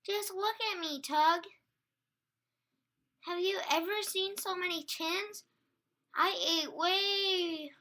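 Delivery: high, loud, wailing lament; tearful. A narrator's reading. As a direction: moan about it in despair. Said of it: "She started to cry again".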